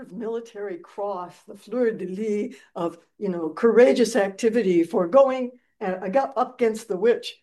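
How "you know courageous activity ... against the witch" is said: usual speaking voice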